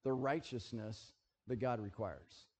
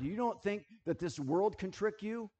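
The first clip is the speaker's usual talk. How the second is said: normal talking voice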